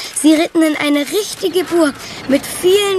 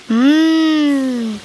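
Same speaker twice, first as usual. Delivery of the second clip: long relishing "mm"; savouring hum. Acted. Felix on the evening cooking smells, long savouring hum.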